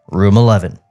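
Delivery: usual speaking voice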